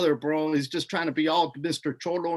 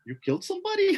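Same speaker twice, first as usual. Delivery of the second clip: high, startled retold question; imitating a stunned friend; shocked question; 13 semitones higher, faster than usual. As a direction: sound floored that he killed someone.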